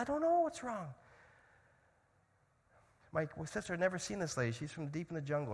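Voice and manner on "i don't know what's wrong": distressed mother. Retold or imitated. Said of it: "The mother's like".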